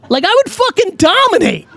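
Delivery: high-pitched, emphatic enthusiasm; excited boast. Real, not acted. Host fantasising about having an accent, excited and high. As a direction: crow it high, punching the key word.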